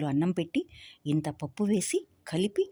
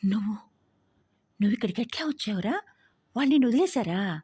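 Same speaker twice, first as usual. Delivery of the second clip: joyful rapid questions; 5 semitones higher, faster than usual. A narrator's reading. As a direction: tumble the questions out in delight. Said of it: "ఆనందంగా, బిగ్గరగా, గబగబా అడిగేసింది".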